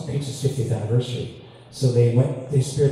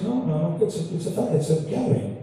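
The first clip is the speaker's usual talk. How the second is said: flustered correction; 6 semitones higher than usual. Retold or imitated. Inviter fumbles to correct him again.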